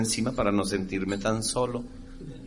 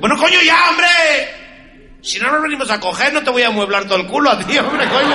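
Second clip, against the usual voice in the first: put-on much higher, loud furious Spanish-accented shout; angry Spaniard; 13 semitones higher, 8 dB louder than usual. Fed-up Spanish man yelling at his partner, high furious shout.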